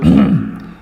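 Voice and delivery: a deliberate throat clearing. Shown as a demonstration. Lecturer shows students a throat clear, deliberate clearing. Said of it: "clear our throat".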